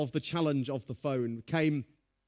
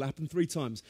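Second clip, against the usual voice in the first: ordinary talk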